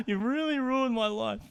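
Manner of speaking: hammed-up high wailing sob; mock crying. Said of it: "He's crying".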